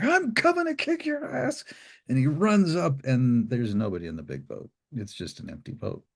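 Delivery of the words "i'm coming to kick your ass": high-pitched furious threat; angry threat. Retold or imitated. Angry fisherman threatening the boat's crew, high angry voice. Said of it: "And he's like".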